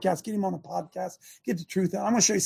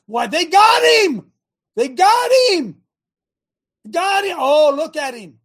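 higher, louder, slow mock triumph; mock gotcha; 7 semitones higher, 6 dB louder than usual. Real, not acted. Host mocking the court's evidence, slow mock triumph. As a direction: crow it like a sarcastic gotcha.

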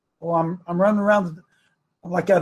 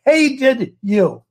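raised, drawn-out emphatic cry; impassioned preaching; 8 semitones higher, 4 dB louder, much slower than usual. Real, not acted. Host telling Jeff that they hated him, impassioned preaching. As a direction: hammer each repetition slowly, pitched high. Preacher driving home a grievance.

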